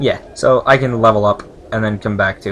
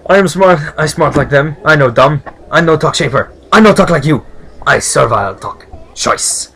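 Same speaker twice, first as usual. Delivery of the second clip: loud, higher, punchy broken-English servant; rough servile speech; 4 semitones higher, 10 dB louder than usual. Acted. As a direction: insist proudly in choppy servant talk. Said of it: "This is how they usually talk".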